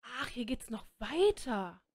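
ordinary talk, nothing marked

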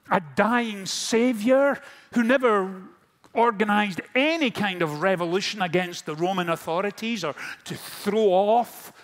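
preacher at full force, voicing Peter's lost hope; impassioned preaching. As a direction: drive it home from the pulpit.

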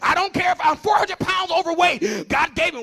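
loud, high, impassioned pulpit shout; full-force preaching. Acted. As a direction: drive each declaration home to the congregation at top volume.

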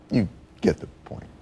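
normal talking voice

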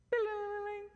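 high sing-song ring; phone ringtone imitation. Imitating the phone interrupting a couple's fight, high chime.

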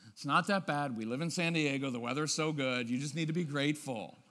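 low, even minimizing; dismissive placating husband. Acted. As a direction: talk the wife down in a low, soothing drone. Husband talking wife out of it.

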